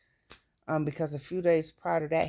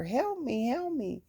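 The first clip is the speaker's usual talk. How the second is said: retold plea for help; 9 semitones higher than usual. From the roof begging the lady for help, high. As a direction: imitate crying out to the lady below. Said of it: "'cause I'm telling her".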